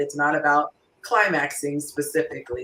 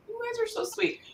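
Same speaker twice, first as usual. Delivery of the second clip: very high, soft aside; touched cooing; 10 dB quieter than usual. Real, not acted. Host reacts to the guys' kind answers, cooing softly. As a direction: coo it in a tiny high voice.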